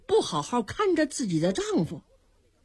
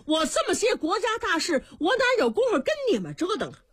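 fast, high-pitched impatient scolding; exasperated anger; 8 semitones higher, faster than usual. Acted. Angry emperor scolds the dwarfs, fast and exasperated. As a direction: snap at them, out of patience. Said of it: "武帝气坏了".

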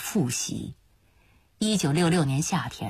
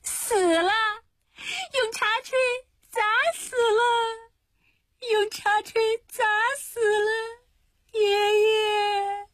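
high-pitched, broken sobbing cries; shrill crying wail; slower than usual. A narrator's reading. Yingshuang crying out that someone was killed, shrill wailing. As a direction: wail it in slow, drawn-out bursts. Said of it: "突然尖声哭叫起来".

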